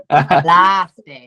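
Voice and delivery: the horse's name in a hammed-up Irish accent. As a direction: toss it off in a mock Irish lilt. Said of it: "didn't say that in Irish accent".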